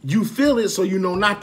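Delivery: forceful. Real, not acted. Man preaching to viewers about anger, forceful. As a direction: hammer the point home.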